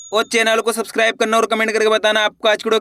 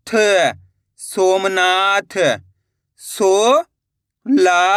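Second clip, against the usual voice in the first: syllable-by-syllable reading drill; much slower than usual. Shown as a demonstration. Teacher to pupils, blending Hindi syllables into words, deliberate drill. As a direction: sound out each syllable, then say the whole word slowly.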